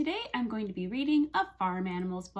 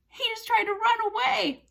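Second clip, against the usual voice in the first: very high, quick exclamation; playful alarm; 12 semitones higher, 4 dB louder, faster than usual. Pretend. Teacher's toy horse slips away, high quick surprise. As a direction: blurt it out in mock surprise.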